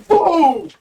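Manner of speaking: loud, high explosive burst; big punched sound effect; booming impact noise. Retold or imitated. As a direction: imitate a sudden kick landing. Imitating Chaz's sudden kick.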